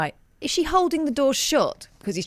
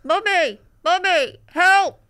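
echoey muffled cry; 9 semitones higher, 6 dB louder, much slower than usual. Retold or imitated. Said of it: "A slightly echoey muffled cry".

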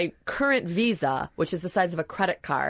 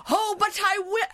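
hammy amateur actor. Retold or imitated. Mocking a bad actor's show, hammed-up stage voice. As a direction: overact it like bad amateur theatre. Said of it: "pure Waiting for Guffman".

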